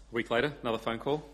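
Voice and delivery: usual speaking voice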